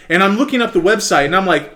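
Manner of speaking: ordinary talk, nothing marked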